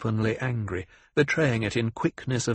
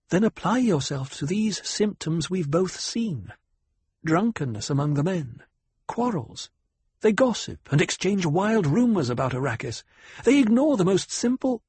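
higher, composed noble lady voice; lightly voiced commanding noblewoman; cool noble lady; 7 semitones higher than usual. Noble lady lists symptoms.

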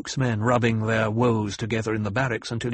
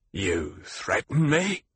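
growled; slower than usual. A narrator's reading. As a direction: growl the question slowly. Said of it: "he growled".

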